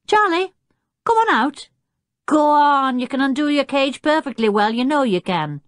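high, coaxing call to an animal. A narrator's reading. Jeremy calls the chimpanzee out of his cage, coaxing. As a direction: urge him out, starting high and easing down.

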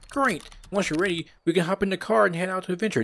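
very high, eager sidekick voice; high put-on game character voice; Bob. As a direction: pitch it way up, keen to set off.

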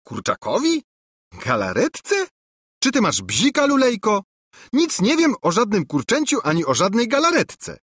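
high, loud incredulous princess; a princess mocking a silly claim. A narrator's reading. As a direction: scoff in disbelief as the princess. Angelika denies sending chicken.

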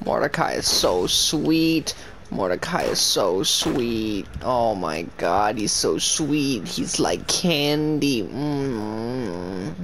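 syrupy teasing adoration; mock gushing. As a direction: gush over him like a sweetheart. Gushing over a character.

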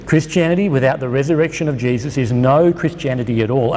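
usual speaking voice

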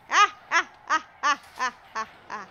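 loud burst of laughter fading out; laughing. Real, not acted. Laughing at the spiders and knowing she shouldn't. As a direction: cackle at the spiders' bad luck. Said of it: "I should not be laughing".

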